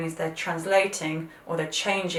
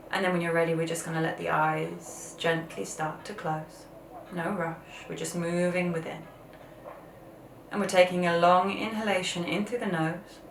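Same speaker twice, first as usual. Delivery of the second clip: guided meditation. Acted.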